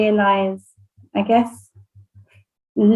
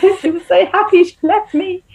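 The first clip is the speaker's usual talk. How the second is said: higher, louder, hammed-up wail; mock wail; 10 semitones higher, 4 dB louder than usual. Mum telling her husband about drop-off in a mock wail. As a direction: whine it like a jilted mum, half joking. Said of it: "I was like".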